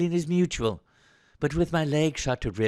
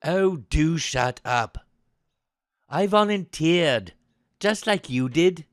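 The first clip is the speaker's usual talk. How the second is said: slow, emphatic, raised-voice rebuke; offended, biting back; 2 semitones higher, 5 dB louder, slower than usual. Acted. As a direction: snap back at the slight. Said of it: "takes offense at this suggestion and bites back".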